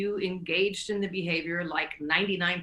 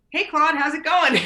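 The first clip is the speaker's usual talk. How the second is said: higher, louder cheery hello; bright mock greeting; 9 semitones higher, 8 dB louder than usual. Friends greeting Claude in a chirpy mock hello. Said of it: "Holly and Jenny were just like".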